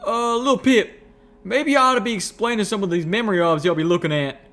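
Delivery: high, loud country nudge, settling as it goes; Calamity's folksy voice. A narrator's reading. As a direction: prod a friend with rustic concern.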